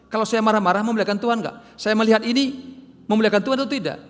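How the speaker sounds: preaching at full force. Acted. Pastor preaching loudly to his congregation about bearing fruit. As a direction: hammer the message home to the church.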